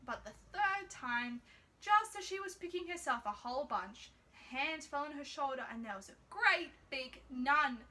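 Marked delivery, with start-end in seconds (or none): none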